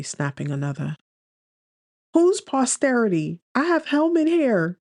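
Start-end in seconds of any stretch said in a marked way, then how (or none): 2.1-4.8 put-on high, loud girl's protest; loud high-pitched protest; laughing exclaim. A narrator's reading. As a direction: voice her outburst way up and loud. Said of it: "she exclaims with a laugh".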